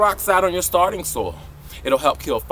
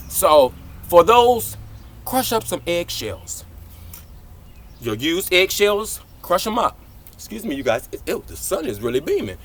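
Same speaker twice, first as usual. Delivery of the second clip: ordinary delivery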